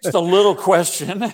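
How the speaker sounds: high, loud, laughing understatement; laughing irony. Real, not acted. Priest joking to host about the question, laughing. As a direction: laugh it out as a joke.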